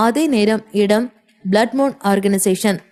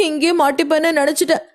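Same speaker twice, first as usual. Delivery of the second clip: high-pitched, sobbing through the words; acted sobs, gulping for breath in fear and relief; tearful sobbing; 7 semitones higher, faster than usual. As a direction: cry it out like a frightened child. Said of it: "என்று தேம்பி தேம்பி அழுதாள்".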